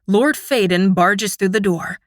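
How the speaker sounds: usual speaking voice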